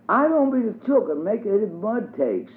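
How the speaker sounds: put-on small and careful like the youngest child; tiny child voice for Tot. A narrator's reading.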